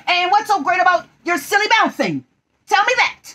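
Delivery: high, loud, fussy rabbit voice; irritated Rabbit. Acted. Rabbit challenging Tigger about bouncing, high and loud.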